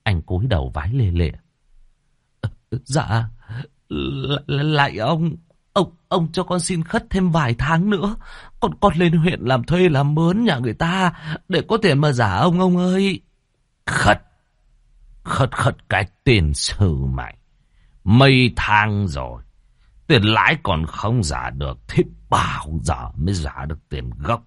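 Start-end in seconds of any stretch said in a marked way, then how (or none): 2.4-13.2 higher, stammering plea to the landlord; frightened begging. Acted. Debtor begs landlord, stammering. 13.9-24.4 threatening landlord. Acted. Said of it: "Lão Khiêm vừa dọa nạt".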